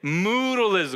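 Moodalism with a long drawn moo on the first syllable; cow-like moodalism. Shown as a demonstration. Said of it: "So we can all sound like cows".

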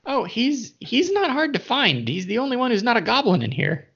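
a scheming goblin, pitched well up; sly goblin voice. Acted.

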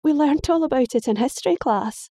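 high, exasperated protest; indignant girl. Acted. Daughter voiced, high.

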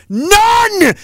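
sudden yelled "none"; angry shout. Real, not acted. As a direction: bark out "none" in rising fury. Ranting at politician.

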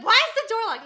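loud annoyed question. Retold or imitated. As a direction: bark the question through the door. Boyfriend annoyed at locked door.

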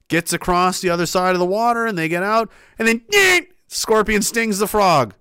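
usual speaking voice

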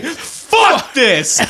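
high-pitched imitation of an angry fan; mock huffy vitriol. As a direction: huff it out in pretend outrage. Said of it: "in a huff with vitriol".

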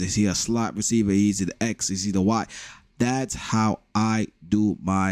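normal talking voice

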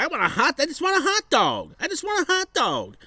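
very high-pitched mimicry of a crazy woman; mock crazy-lady squeal. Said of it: "she was crazy".

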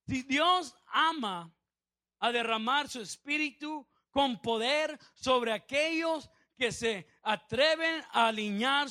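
slow, weighty pulpit delivery; emphatic preaching. Real, not acted. Preacher urging the congregation to be radical, slow emphatic preaching. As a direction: drive home each phrase, stretching the key words.

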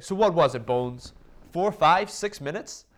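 high, loud mock shout; imitated golfer calling over. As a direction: toss a teasing question loudly across the range. Phil's acted answer shouted back.

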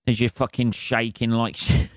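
ordinary talk, nothing marked

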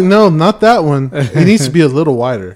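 loud, emphatic retold refusal; insistent customer. Shopper being shown all the trash cans, loudly insistent. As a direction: reject it loudly, half amused. Retells rejecting cans, amused.

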